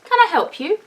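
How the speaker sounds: lightly voiced polite bear. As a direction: greet them like a well-mannered bear. Bear offers help.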